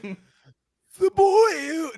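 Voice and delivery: high, very slow, drawn-out villain voice; mock Voldemort line, high and slow. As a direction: stretch it out like a dark lord. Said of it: "a little bit of a British accent".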